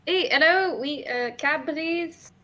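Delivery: loud, high call for a cab; yelling out. Acted. Character calling after a cab in the distance, yelling out. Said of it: "you're yelling out".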